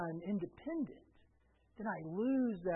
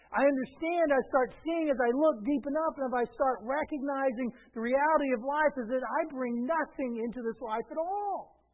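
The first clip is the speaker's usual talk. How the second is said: raised, higher preacher voice; forceful preaching; 8 semitones higher, 8 dB louder than usual. Real, not acted. Pastor voicing a doubter to the congregation, forceful preaching. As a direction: preach it out with driving insistence.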